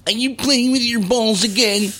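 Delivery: high nagging mother voice; his mother's voice. Retold or imitated. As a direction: nag like a fed-up mom. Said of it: "His mother used to ... and go".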